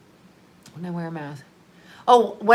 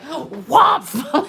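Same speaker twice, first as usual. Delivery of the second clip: mock jump-scare shout; 5 semitones higher, 3 dB louder than usual. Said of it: "like this".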